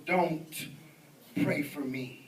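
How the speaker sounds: low, measured mock menace; devil worshipper's warning. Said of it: "And he goes".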